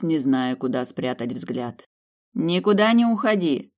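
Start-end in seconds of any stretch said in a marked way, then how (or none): 2.3-3.7 high, emphatic instruction; warning. A narrator's reading. Kioshi leaving for the bathroom, warning heroine. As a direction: stress the warning before leaving. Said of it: "предупредил Киоши".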